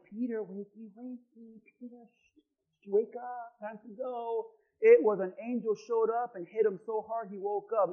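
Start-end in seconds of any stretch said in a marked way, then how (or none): 0.0-4.5 much softer, lilting wakey wakey; gentle wake-up. Retold or imitated. Angel gently waking Peter, as pastor mocks softly. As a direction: coo it like waking a child. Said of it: "it doesn't mean like".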